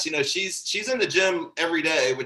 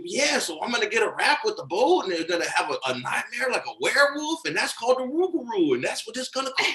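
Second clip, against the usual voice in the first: higher, swooping imitation of a friend's accent; Keith's accent; 6 semitones higher than usual. Imitating Keith explaining his boat's name, in Keith's accent. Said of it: "doing my best Keith accent here".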